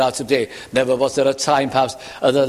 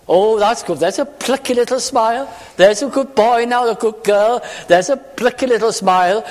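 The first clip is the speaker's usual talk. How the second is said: put-on high, chirpy praise as if to a small child; mock a coaxing well-wisher, pitched up; patronising encouragement; 6 semitones higher than usual. Preacher mimicking praise for a brave front, mock-patronising encouragement. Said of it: "It's more than a brave front".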